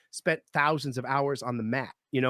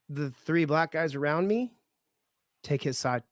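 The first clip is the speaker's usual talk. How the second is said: ordinary talk, nothing marked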